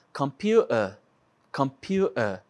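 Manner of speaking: "computer" com golpe de glote no lugar do T; T omitido. Shown as a demonstration. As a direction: diga "computer" como "compiú-a". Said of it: "tirando a letra T". ("computer" sem T e sem R)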